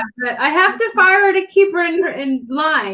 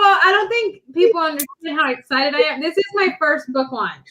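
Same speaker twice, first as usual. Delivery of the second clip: usual speaking voice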